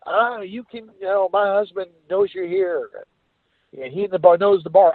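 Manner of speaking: a light take on a fretting woman; imitating Sue. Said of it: "I said".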